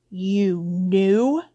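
slow dawning disbelief, swooping up at the end; astonished question. A narrator's reading. Harry learns his relatives knew all along, astonished. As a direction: ask it as a stunned realization. Harry confronts aunt and uncle.

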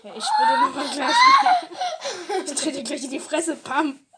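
shrieking high through laughter, then a loud mock threat; laughing shout. Teasing friend, yelling a mock threat through laughter. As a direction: yell it half laughing, play-angry.

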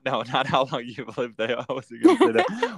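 talking through real laughter; laughing. Real, not acted. Host backtracking on a question to the guest, laughing. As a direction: laugh your way through a self-correction.

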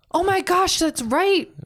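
a real surprised outburst; excited realization. Real, not acted. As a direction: gasp in remembering. Sudden recall of plot twist.

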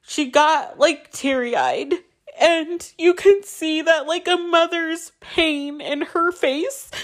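high, slow, choked-up voice; tearful. Real, not acted. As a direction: hold back real tears while telling it, pitched high. Moved recalling her teary mother.

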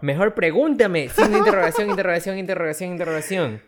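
mocking the bio while laughing, pitch up; burla riendo. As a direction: read it out mockingly, laughing, high and loud. Imita la bio con signos. (burlándose entre risas, más agudo y fuerte)

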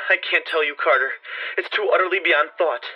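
high, despairing, frightened voice; hoarse with fear. Acted. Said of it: "hoarse with fear and now apparently tinged with despair".

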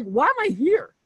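high, exasperated imitation of a star who feels wasted; mock bewildered star athlete; Ken Griffey's frustration. Host imitating Ken Griffey Junior, high and exasperated. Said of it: "Like, he's, like".

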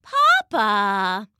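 lightly voiced, hurt Mama; a touch of reproach, drawn out; reproachful Mama. A narrator's reading. Mama answers Papa's complaint, reproachful.

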